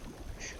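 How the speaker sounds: short quiet breathy giggle; a stifled little laugh; soft giggle. Acted. Woman tickled by jets, quiet stifled giggle.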